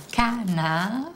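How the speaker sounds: "canard" drawn out, rising at the end; "canard" syllable by syllable, questioning; syllable split. Shown as a demonstration. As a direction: say "canard" slowly in two beats, as a question. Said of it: "dans la première syllabe ou dans la deuxième".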